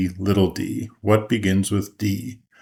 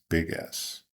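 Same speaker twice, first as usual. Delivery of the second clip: whispered; 4 semitones lower, 10 dB quieter than usual. A narrator's reading. Ending the letter verse softly.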